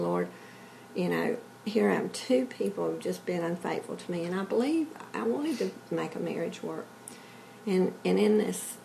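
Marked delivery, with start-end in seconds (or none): none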